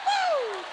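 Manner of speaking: high breathless "woo"; a high falling whoop. Real, not acted. Ecstatic cry, softer.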